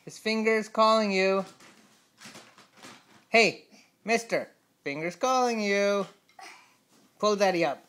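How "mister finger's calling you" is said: ordinary talk, nothing marked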